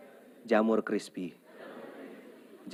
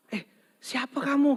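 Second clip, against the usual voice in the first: husband asking; 12 semitones higher than usual. Acted. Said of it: "kata si suaminya ini".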